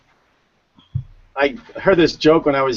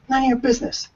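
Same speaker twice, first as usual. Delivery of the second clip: higher, lightly voiced kid; cheeky kid; 10 semitones higher than usual. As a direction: give the kid's name with a deadpan cheek. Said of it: "He goes".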